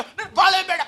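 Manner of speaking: high-pitched, hammed-up cry of despair; despairing husband. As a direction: wail it like a husband at breaking point, played for laughs. Acts out despairing angry husband at wall.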